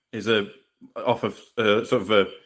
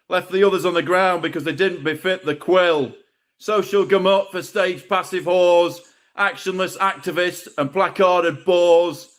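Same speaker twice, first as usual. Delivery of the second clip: raised, rhythmic poetry-slam delivery; spoken-word poem; 8 semitones higher than usual. Acted. Poet performing his piece to the open-mic crowd, declaiming. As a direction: declaim each line to the room.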